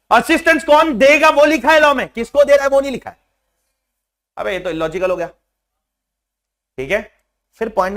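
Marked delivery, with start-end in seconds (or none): 0.1-3.2 emphatic loud explanation. Real, not acted. Teacher drives home the legal point.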